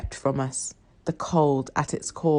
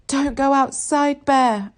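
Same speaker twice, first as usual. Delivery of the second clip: higher, strained cry that barely carries; weak scream; 8 semitones higher, slower than usual. A narrator's reading. Sick sister crying out to her little brother, a weak scream. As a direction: try to scream but have no strength. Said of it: "I scream, though it comes out more of a whisper".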